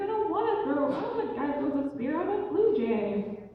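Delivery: put-on high-pitched girlish protest; acted stubborn daughter, pitched well up. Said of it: "And she's like".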